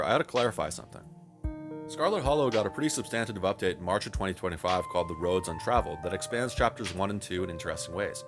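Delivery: ordinary talk, nothing marked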